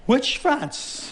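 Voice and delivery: high startled question; surprised. Acted. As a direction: jump up in disbelief. King hearing France is enemy.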